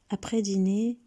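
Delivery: ordinary delivery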